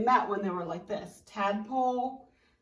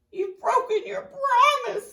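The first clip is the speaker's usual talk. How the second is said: much higher, loud crying wail; hurt accusation; 13 semitones higher, 9 dB louder than usual. Acted. Caterpillar cries out at tadpole, loud high wail. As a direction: wail it, heartbroken.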